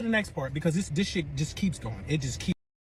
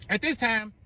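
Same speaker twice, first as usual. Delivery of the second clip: ordinary talk, nothing marked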